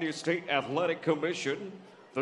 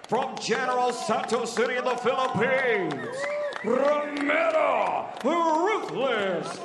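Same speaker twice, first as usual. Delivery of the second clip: much higher, slow, held shout; fighter name call; 9 semitones higher, 5 dB louder, slower than usual. Acted. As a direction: stretch the name like a ring call. Announcer calls fighter's name.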